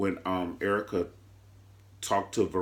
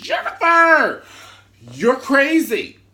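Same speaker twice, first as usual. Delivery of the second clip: much higher, much louder slow yell; mock shout; 13 semitones higher, 10 dB louder, slower than usual. Jim yelling at Catherine about her lies, mock shout. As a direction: bellow the name out.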